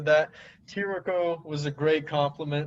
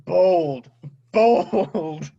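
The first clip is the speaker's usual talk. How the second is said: loud, higher, punchy reaction; mock-impressed exclamation; 4 semitones higher, 8 dB louder than usual. Co-host reacting to a friend's Niners pick, loud mock-impressed call. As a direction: call it out big, stretching the repeat.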